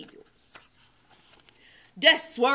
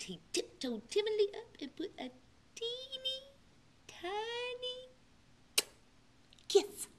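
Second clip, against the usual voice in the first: softer, climbing to a tiny high; hushed; 11 semitones higher, 11 dB quieter than usual. A narrator's reading. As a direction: hush it as you tiptoe. Tiptoeing, tiny kiss.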